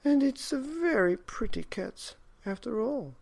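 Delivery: put-on high, loud old woman admiring; old woman admiring the cat, high. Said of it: "said the very old woman".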